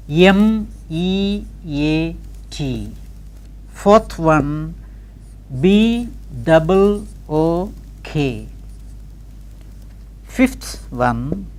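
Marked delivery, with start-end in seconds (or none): 0.1-2.9 slow letter-by-letter dictation; spelled out. Shown as a demonstration. Teacher dictating words to transcribe, slowly spelled out. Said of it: "I shall spell each word". 5.6-8.5 slow letter-by-letter dictation; spelled out. Shown as a demonstration. Teacher dictating words to transcribe, slowly spelled out. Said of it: "I shall spell each word".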